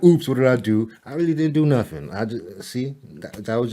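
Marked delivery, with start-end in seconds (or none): 0.0-0.9 replaying the slip, louder and brighter; hammed-up oops for the viewer; mock startled oops. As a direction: act out a mild mock panic. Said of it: "I was like".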